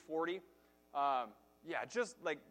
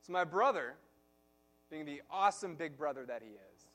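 ordinary delivery

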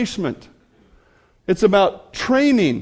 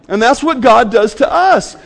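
ordinary talk

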